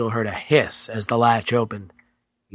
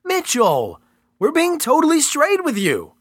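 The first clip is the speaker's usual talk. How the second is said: most sincere voice; 13 semitones higher than usual. Acted. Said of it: "in his most sincere voice".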